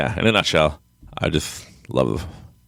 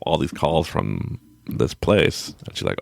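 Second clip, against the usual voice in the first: ordinary talk, nothing marked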